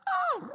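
very high peacock squawk; peacock call. Retold or imitated. Dad demonstrating the peacock sound he found, squawking. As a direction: cry out shrilly like a peacock.

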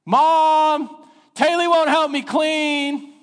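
put-on high, slow whining kid calling to mom; a child telling on his sister; tattling child. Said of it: "what's the next thing that happens".

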